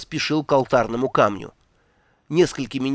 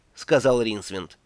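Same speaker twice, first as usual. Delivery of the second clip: usual speaking voice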